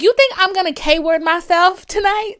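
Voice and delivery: high, shouted disbelief, hammed-up; loud incredulous retort. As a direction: fire the question back loud and high. Acted incredulous retort to therapist.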